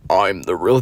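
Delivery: annoying fry voice. Retold or imitated. Mimicking him coming up to brag, fry voice. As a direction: creak it out in vocal fry. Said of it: "this annoying ass fry voice".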